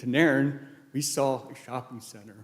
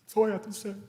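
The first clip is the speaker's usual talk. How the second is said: high voice still full of tears; real tearful close; 8 semitones higher, faster than usual. Real, not acted. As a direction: get the last words out through crying. Ending eulogy in tears.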